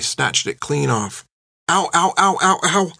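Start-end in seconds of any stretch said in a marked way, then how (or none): 1.7-2.9 high yelping ows as an ear is twisted; boy yelping in pain, high and quick; pained yelps. A narrator's reading. Boy with ear yanked, high pained yelps. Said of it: "said John".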